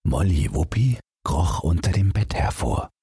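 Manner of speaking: plain reading voice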